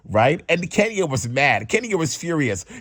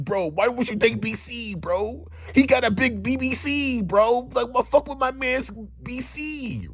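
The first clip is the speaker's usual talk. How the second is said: mock Ryan voice; 9 semitones higher than usual. Voicing Ryan's awful wingman pitch.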